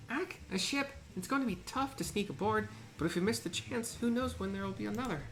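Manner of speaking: high excited voice. Acted. Voices another companion.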